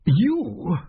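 suddenly unsure of himself. Acted. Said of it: "sounding altogether less certain".

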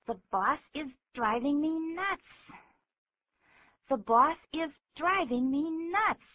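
fed up, pitch pushed up; angry griping. Shown as a demonstration. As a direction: grumble it tensely with teeth clenched. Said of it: "生气的时候，好像牙齿会真的紧闭着".

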